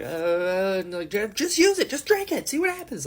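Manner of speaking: high-pitched, baffled mock voice; hammed-up confused henchman; mock helpless voice. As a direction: squeak it out like a clueless underling.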